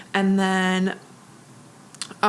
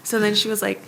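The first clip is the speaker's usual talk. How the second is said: ordinary delivery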